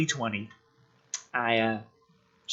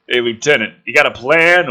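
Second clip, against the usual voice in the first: calling out; 8 dB louder than usual. Acted. Soldier calls out to McKenna.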